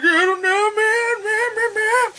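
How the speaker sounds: high strained whimpering; panicky whine. Retold or imitated. Guy at the door, high panicked whining. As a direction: plead in a high helpless falsetto. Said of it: "the guy's like".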